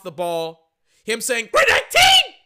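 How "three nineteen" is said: much higher, louder quarterback audible call; high-pitched falsetto yell of a play call; falsetto scream. Retold or imitated. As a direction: scream it in a cracking falsetto like a QB at the line. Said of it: "In that high-pitched falsetto voice screaming".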